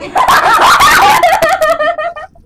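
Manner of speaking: high squeaky laugh; shrieking giggle; helium laugh. Real, not acted.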